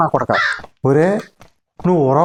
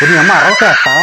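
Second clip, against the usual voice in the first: shout; 7 dB louder than usual. Acted. Yells at a screaming girl.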